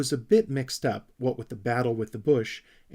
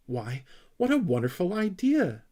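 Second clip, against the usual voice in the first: admiring exclamation; 8 semitones higher, 4 dB louder than usual. A narrator's reading. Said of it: "exclaimed Moomintroll".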